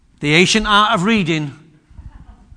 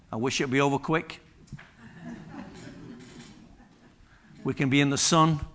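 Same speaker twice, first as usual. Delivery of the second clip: weary hushed wish; 5 semitones lower, 10 dB quieter, faster than usual. Acted. Quoting a weary person's thoughts.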